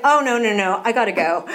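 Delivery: loud flustered bail-out; loud panicked refusal. Retold or imitated. Telling staff she must leave, loud and flustered. Fleeing after hearing cost.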